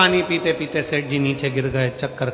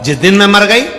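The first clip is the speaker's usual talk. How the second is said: scolding threat; 4 semitones higher, 10 dB louder than usual. Retold or imitated. Elder scolds household about her death.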